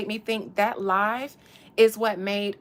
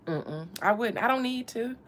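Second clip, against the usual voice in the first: ordinary delivery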